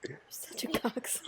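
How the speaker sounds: whispered teasing insult; whispering. Real, not acted. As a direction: breathe it out as a whisper. Whispered insult between friends.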